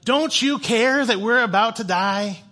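loud, high, drawn-out retold protest; freaking out. Fishermen plead with sleeping Jesus, acted freak-out. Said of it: "they're freaking out".

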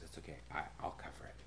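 very soft, low comforting voice; imitated tender, hushed promise; gentle reassurance. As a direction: quietly offer to take on someone's debt. Retold Jesus reassuring the debtor.

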